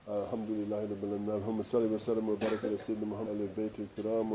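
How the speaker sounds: fast, soft recited supplication; rapid Arabic prayer. Acted. Preacher closing the lesson with a prayer, rapid soft Arabic recitation. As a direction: rattle off the closing dua quickly and softly.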